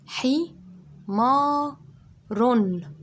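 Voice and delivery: high and loud, رن ending in a clear n; syllable by syllable. Shown as a demonstration. Teacher sounds out the word so children hear the tanween. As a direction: sound out each syllable, ringing the final رن. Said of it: "اكتب معايا".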